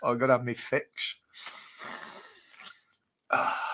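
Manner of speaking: normal talking voice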